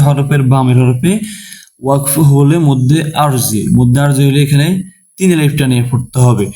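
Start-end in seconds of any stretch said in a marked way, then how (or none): none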